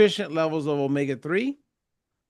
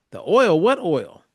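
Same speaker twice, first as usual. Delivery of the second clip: higher, louder puzzled question; retold surprise; 5 semitones higher, 6 dB louder than usual. Father questioning his daughter about the oil, surprised. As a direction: act out being caught off guard by the answer. Acts out his startled reply.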